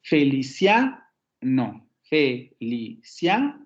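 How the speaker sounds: "Felicia" drawn out syllable by syllable, CIA rising; slow verse-scanning demo, "fe-li-CIA"; stress on the third syllable. Shown as a demonstration. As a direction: scan "Felicia" very slowly, lifting the final CIA. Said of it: "Sería el acomodo de los acentos".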